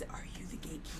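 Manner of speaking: fast, soft whispered question. Pretend. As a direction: whisper it to him.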